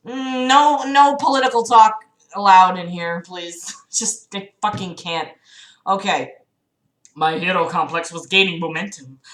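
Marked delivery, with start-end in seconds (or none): none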